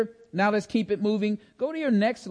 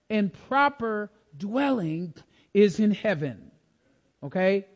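normal talking voice

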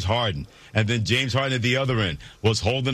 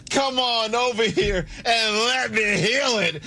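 high, drawn-out revival preaching; mock faith healer; 9 semitones higher, slower than usual. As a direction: call the hurting forward to be healed. Acts coach as faith healer.

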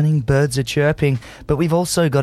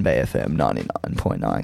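much lower, savoring each syllable; deep radio announcer voice; 5 dB quieter than usual. Shown as a demonstration. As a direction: relish the station name like a jingle. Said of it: "'cause I love to say it".

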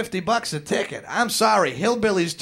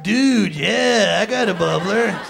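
loud, hammed-up stoner voice; stoner dude; 4 semitones higher, 6 dB louder, slower than usual. Stoner dude answering a request for a bubbler, hammed up. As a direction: play an over-eager bong-loving dude.

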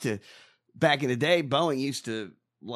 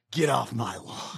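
lower, slow mock Clint Eastwood growl; Clint Eastwood impression; 3 semitones lower, slower than usual. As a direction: do a grumpy Clint Eastwood, slowly. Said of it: "Clint Eastwood say?".

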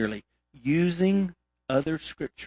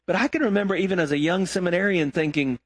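ordinary delivery

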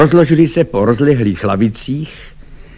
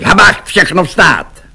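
raised, loud military order; called-out command; 7 semitones higher, 5 dB louder, slower than usual. A narrator's reading. Švejk calls everyone up as the lieutenant enters, loud command. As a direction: call the room to attention. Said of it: "zvolal Švejk".

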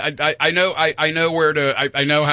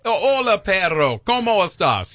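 Spanish to a dog; 5 semitones higher than usual. Shown as a demonstration. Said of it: "I can do, I can do".

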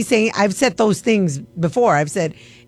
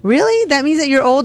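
shoots way up in disbelief; can't believe what she's reading; incredulous; 6 semitones higher than usual. Real, not acted. DJ reading the survey on air, incredulous.

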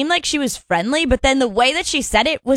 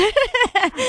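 bright burst of laughter; high laugh; 11 semitones higher than usual. Real, not acted. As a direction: laugh out, amused. Laughs at the joke.

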